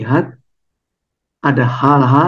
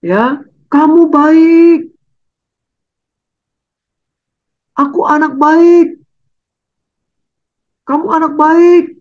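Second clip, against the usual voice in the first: put-on high, lively voice coaxing the child to go back; mother coaxing child; 10 semitones higher, 3 dB louder than usual. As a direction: urge the child gently but brightly. (ibu membujuk anaknya dengan suara tinggi)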